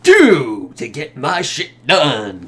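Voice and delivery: loud, slow mock endorsement; hammed-up, blatant sponsor plug; in-your-face plug. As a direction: hit the product hard and stretch it out. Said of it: "it's just almost in your face".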